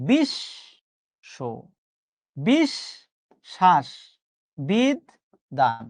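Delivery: "বিশ্ব" split into bish-sho, halves held apart; syllable-by-syllable pronunciation. Shown as a demonstration. Teacher showing students how it is really said, syllable by syllable. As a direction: break "বিশ্ব", "বিষ, শ্বাস" and "বিদ, দান" into separate syllables. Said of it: "উচ্চারণ করছি আর একভাবে".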